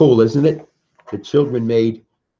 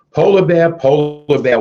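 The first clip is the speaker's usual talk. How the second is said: lively read-aloud call; 6 semitones higher, 6 dB louder than usual. Acted. Reading picture book aloud to kids.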